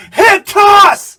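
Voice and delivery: very loud, high shout; yelling. Retold or imitated. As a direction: bellow the spot call across the ring. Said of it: "just yell".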